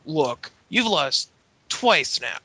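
high-pitched, emphatic mock dressing-down; put-on high voice, slow stern warning; imitated boss's ultimatum. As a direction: lay down the law as a fed-up boss. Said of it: "Teddy basically go-, tells Drew".

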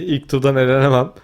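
ordinary talk

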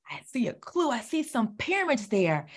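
secret hint whispered. Acted. Teacher whispers a clue to the children.

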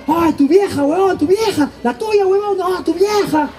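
high urgent teasing cries; kids' warning shouts. Retold or imitated. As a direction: warn your friend his mom is coming. Kids warning at the park. (gritos de aviso de los chicos)

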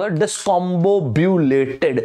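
discombobulated stretched out very slowly, every syllable clear. Shown as a demonstration. Teacher slowly pronouncing discombobulated for students to repeat. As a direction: spell discombobulated out slowly, dis-com-bob-u-la-ted. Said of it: "एक tough word देता हूं".

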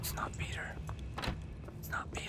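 hushed insistent whisper. Real, not acted. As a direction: whisper it to yourself. Correcting game in the dark.

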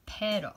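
"pero" with a tapped r, Spanish vowels. Shown as a demonstration. Teacher showing how to say pero in Spanish. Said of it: "Can you say pero".

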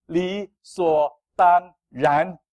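理所当然 dragged out word by word, loud; emphatic slow. Acted. Pastor drives home 理所当然 to the church, slow and loud. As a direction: spell out 理所当然 slowly, each syllable weighted. Pastor hammers key phrase.

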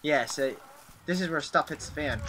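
ordinary talk

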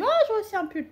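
put-on high, light child's voice for the daughter; daughter's voice. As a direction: imitate her little girl asking for a sweater. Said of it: "ma fille l'a vu, me dit". (voix aiguë de petite fille qui réclame)

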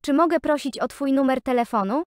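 usual speaking voice

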